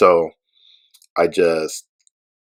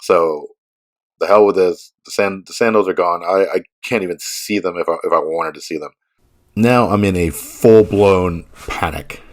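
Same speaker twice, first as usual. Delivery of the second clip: usual speaking voice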